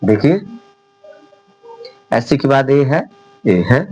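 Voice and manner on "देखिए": normal talking voice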